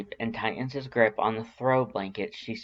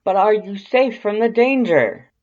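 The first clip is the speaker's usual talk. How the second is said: much higher, much louder urgent question; urgent Papyrus; 11 semitones higher, 11 dB louder than usual. A narrator's reading. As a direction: blurt it out in alarm as Papyrus. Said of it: "he asks urgently".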